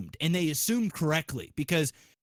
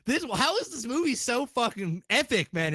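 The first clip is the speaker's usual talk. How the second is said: thrilled gush; 5 semitones higher than usual. Retold or imitated. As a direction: let the excitement spill over. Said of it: "I was like".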